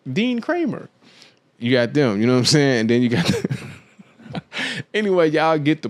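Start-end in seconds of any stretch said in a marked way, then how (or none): 0.1-0.9 much higher, put-on introduction voice; nerdy guy impression. Mocking the buttoned-up guy at a house party, much higher put-on voice. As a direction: play the stiff guy announcing his name, way up high. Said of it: "old boy was like this".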